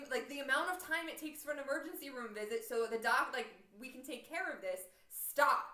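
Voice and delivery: loud, high, emphatic retold plea; exasperated demand. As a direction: act out firmly demanding space, loudly. Acted plea to frantic mom.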